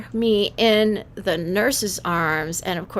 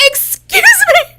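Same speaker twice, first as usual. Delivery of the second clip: put-on very high, slow, affronted baby voice; indignant newborn; 9 dB louder, slower than usual. Slapped newborn protesting to the doctor, high and indignant. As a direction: squeak it out like an offended infant. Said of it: "I was like".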